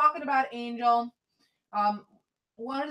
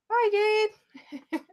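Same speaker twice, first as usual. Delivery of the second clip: cutesy high goodbye; 10 semitones higher, 3 dB louder than usual. Real, not acted. Cutely saying bye to pendulum.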